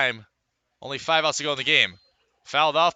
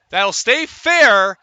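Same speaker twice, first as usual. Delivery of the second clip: excited call; 7 semitones higher, 8 dB louder, much slower than usual. Real, not acted. Bunt play call, excited.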